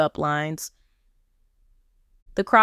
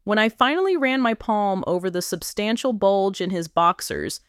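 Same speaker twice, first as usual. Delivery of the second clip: usual speaking voice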